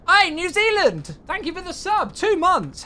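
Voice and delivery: excited shoutout. Real, not acted. Streamer greets New Zealand subscriber, loud excited shoutout. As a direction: boom out a thank-you to the subscriber.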